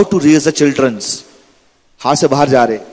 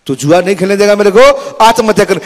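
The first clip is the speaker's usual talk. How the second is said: high, louder bratty threat; mock threat; 6 semitones higher, 4 dB louder than usual. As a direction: threaten papa like a spoilt brat. Son threatens suicide. (ऊँची, धमकी भरी ज़िद)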